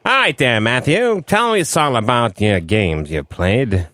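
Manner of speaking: put-on slow, low old-timey drawl; leisurely old-time character voice; 1940s radio host. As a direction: draw it out like a forties wise guy. Plays slow-talking 1940s host.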